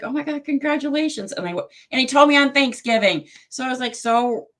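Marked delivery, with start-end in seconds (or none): 0.0-1.3 much higher, replayed delight; excited congratulations. Host retelling how she cheered Travis's win, high and delighted. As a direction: relive the thrill of the good news.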